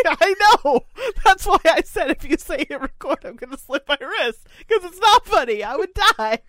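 high and loud through laughter; laughing high. Real, not acted. Defending her joke to her cohost, high laughing voice. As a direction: defend the joke while still cracking up.

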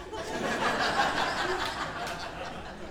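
silent wheezing laugh, high; real laugh of disbelief; breathless high laugh. Real, not acted. Pastor laughing at wife's angel remark, breathless.

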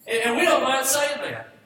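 high, loud preacher's proclamation; shouted declaration. Acted. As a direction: belt it out to the congregation. Preacher repeats line for emphasis.

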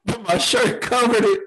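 laughing through the words; chuckling as he tells it. Real, not acted. Laughing while retelling.

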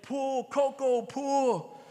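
slow, sing-out command to pull; commanding farmer. Retold or imitated. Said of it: "the farmer commanded".

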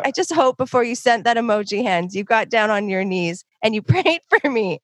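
a hint of a preacher scolding; preacher imitation. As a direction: press the point like a preacher. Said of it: "He's like".

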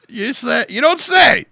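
high, loud mock astonishment; sarcastic you don't say. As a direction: throw it out in exaggerated sarcasm.

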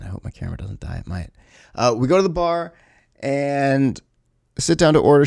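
normal talking voice

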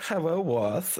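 high, lightly mocking hello; a hint of a confused greeting; imitating contestant. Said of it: "She was like".

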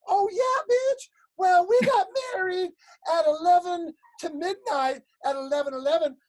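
high, mock-combative retort; sassy one-upping. Audience woman outdoes another couple's wedding, sassy. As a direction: play a woman topping a rival's boast.

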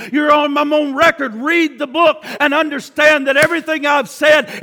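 loud pleading cry, half shouted; God crying out. Acted. Preacher voicing God calling sinners home, loud pleading cry. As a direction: plead like a loving father calling his children back. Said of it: "he's still crying out".

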